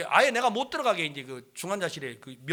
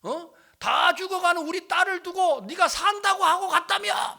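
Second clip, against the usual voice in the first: high voice building to a shout; angry, abusive accusation; 12 semitones higher, 7 dB louder than usual. Retold or imitated. In-law accusing preacher of lying to dying daughter, angry shouting. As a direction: imitate an irate in-law laying into you. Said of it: "욕을 하면서".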